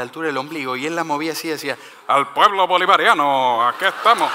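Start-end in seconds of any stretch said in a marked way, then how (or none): 2.1-4.3 loud mock rally proclamation; put-on orator's voice, loud and declaiming; Hugo Chávez. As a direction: boom it out like a leader addressing the people. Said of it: "un tatuaje de Hugo Chávez".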